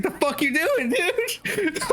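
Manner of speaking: high, laughing mock outburst at the nephew; mock shocked yell. Retelling yelling at his nephew, high and laughing. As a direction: yell at the kid in disbelief, cracking up. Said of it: "I was like".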